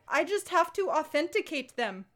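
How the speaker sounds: imitating a film spy's bad Russian accent; mock Russian accent. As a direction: put on a hammy Russian accent. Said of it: "she tells the bad guy".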